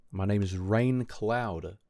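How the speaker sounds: slow, drawn-out name announcement; weird-sounding self-introduction. Real, not acted. Streamer introducing himself to viewers, slow and odd. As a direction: state the name slowly and oddly. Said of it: "sounds weird when I say it like that".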